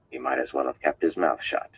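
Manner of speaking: usual speaking voice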